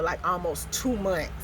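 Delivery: ordinary talk, nothing marked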